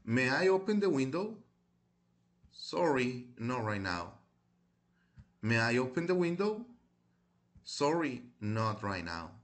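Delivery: careful teacher's model reading, questions lifting at the end; deliberate language-class dictation voice; read-aloud pronunciation model. Shown as a demonstration. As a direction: read each phrase aloud clearly for pupils to repeat. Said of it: "lo voy a leer en voz alta".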